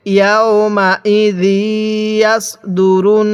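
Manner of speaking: very slow, drawn-out syllables; model recitation. Shown as a demonstration. Teacher models Quran words for learners, slow measured recitation. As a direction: stretch each long vowel evenly. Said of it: "নুনের মধ্যে কেন গুনাহ করছি".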